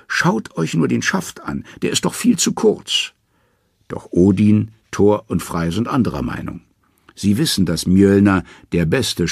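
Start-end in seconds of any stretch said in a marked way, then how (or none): 0.1-3.1 high, pinched trickster voice; high-voiced Loki protesting; Loki, sly and scared. A narrator's reading. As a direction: play the frightened schemer Loki. Loki's voice in the story.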